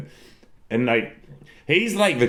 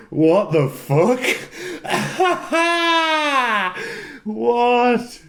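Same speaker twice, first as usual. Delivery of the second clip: shocked disbelief; 12 semitones higher, much slower than usual. Real, not acted. Shocked by a fan question.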